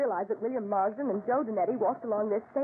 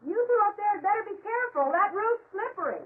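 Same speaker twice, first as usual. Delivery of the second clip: calling up; 8 semitones higher than usual. Acted. Woman calls up to the men on the slippery tomb roof, raised warning.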